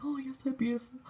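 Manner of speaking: high, soft adoring coo; mock fangirl gushing. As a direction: coo it at an idol. Recounting gushing over Ashton.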